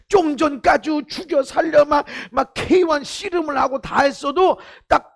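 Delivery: ringing pulpit voice, pressing each point; preaching at full force. Acted. Pastor urging the congregation, preaching at full force. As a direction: preach it out to the whole hall.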